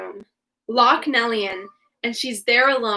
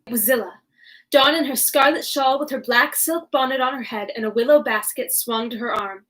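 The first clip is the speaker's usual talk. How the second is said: usual speaking voice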